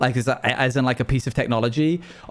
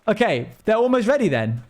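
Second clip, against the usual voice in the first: ordinary delivery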